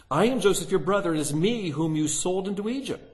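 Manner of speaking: high, loud declaration; loud heartfelt cry. A narrator's reading. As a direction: announce who he really is. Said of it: "He cried in a loud voice".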